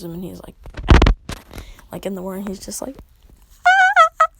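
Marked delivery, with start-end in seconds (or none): 3.7-4.3 loud, very high acted scream; mock scream. Man waking in the morning, high mock scream. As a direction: shriek it out in pulses, hammed up.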